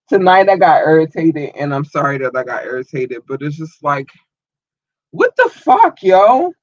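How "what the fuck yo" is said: sudden high, loud what the fuck; real exasperation bursting out; exasperated outburst. Real, not acted. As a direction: blurt it out, fed up. Venting anger at being ignored.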